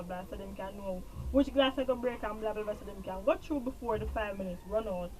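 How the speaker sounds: ordinary delivery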